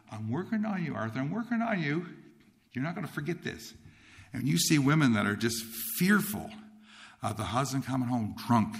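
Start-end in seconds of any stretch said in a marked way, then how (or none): 0.1-3.7 high, lilting quoted voice; lightly voiced singsong call from on high; God. God tells young Arthur He is working on him, singsong. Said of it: "And God says".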